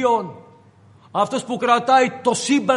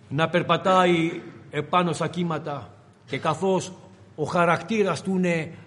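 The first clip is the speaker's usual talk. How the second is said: usual speaking voice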